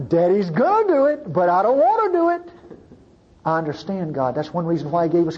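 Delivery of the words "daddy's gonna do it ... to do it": higher, louder, mock reluctant dad; a dad who hates to punish but will; father warning a child. Preacher voicing a father warning his child, mock reluctant.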